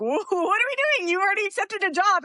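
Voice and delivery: teasing imitation of a confused husband; mock husband voice. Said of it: "my husband's just like".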